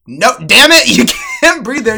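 exasperated mock shout; high, loud scolding as the teacher; Tim. Teacher correcting the same mistake, exasperated shout. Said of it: "Tim would literally go".

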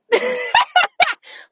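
high, loud burst of laughter; delighted laugh. Real, not acted. Thinking of a friend's humor, delighted laugh. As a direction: crack up at the very thought.

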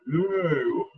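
soft, very slow drowsy murmur; half-asleep softness; sleepy murmur. Shown as a demonstration. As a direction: drift off mid-thought. Drowsy voice demo continues.

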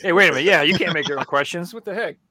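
mock outrage. Real, not acted. Mock protest at quiz rules.

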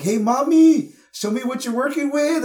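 high, loud leering catcall; mock catcaller. Man catcalling a mom at the gas station, hammed-up leer. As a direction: ham up a sleazy guy calling out from a car. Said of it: "He's like".

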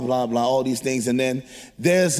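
ordinary delivery

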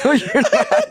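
high, laughing teasing line; laughing tease. Real, not acted. Teasing friend who can't keep straight face, laughing. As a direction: tease her while laughing. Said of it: "can't even try and hold a straight face".

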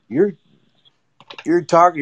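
ordinary talk

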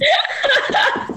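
very high burst of laughter. Real, not acted. Storyteller amused by her own retort, high laughter. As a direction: crack up in a high squeal.

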